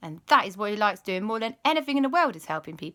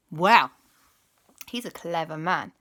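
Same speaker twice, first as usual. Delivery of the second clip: ordinary delivery, nothing marked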